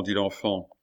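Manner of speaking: usual speaking voice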